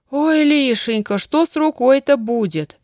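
high, loud, drawn-out lament; испуганный вскрик. A narrator's reading. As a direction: cry out in alarm, pitch well up. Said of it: "вскрикнула тётя Дуся". (горестно вскрикнуть)

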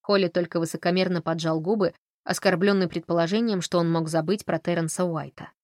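plain reading voice

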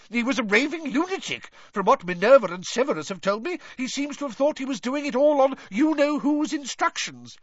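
high-pitched, hurried scoffing; Fudge blustering. A narrator's reading. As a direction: rattle off your dismissal with pompous bluster. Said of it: "blustered Fudge".

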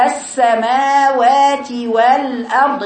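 slow "السماوات والأرض", dwelling on the س; longer seen. Shown as a demonstration. Teacher showing letter timings, slow measured recitation. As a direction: stretch the س of "السماوات" and its long vowels. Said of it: "السين أطول".

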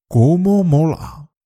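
slow, delighted exclamation; thrilled at a friend's surprise; excited. Shown as a demonstration. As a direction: say it like you love what you see. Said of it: "Fíjate en cómo cambia la entonación".

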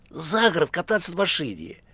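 high, eager patter; chatterbox phone voice. A narrator's reading. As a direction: explain in a high put-on voice. (высокий говорливый голос)

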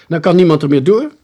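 high, loud explanation; brash advice. A narrator's reading. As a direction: call out the obvious result. Friend loudly gives advice. (luid, brutaal advies)

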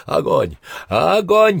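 commander's battle shout, leaping high; loud, high military cry; shouted command. A narrator's reading. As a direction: yell the order to open fire. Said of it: "кричал Иванцов".

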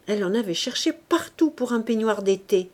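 ordinary delivery, nothing marked

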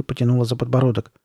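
ordinary delivery, nothing marked

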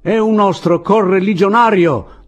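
high, loud exclamation; loud announcement. A narrator's reading. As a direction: proclaim it to fellow soldiers. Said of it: "disse l'uomo della bomba".

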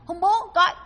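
a dying father's effortful, stiff-tongued plea; imitated stiff tongue. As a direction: imitate stiffened speech, high and loud. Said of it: "mà tiếng hơi cứng á".

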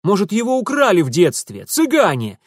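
eager shouted guess. A narrator's reading. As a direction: blurt out the wild theory loudly. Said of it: "крикнул Митя".

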